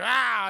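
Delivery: sudden mock yell; startled yelp. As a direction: yelp as if jolted awake. Acting Electro shocked awake.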